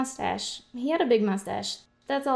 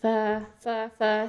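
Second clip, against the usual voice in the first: usual speaking voice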